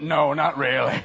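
dry retort. Retold or imitated. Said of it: "And I went".

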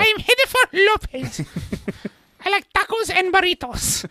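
high squeaky puppet voice. Retold or imitated. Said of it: "the Cartman, [name] type thing".

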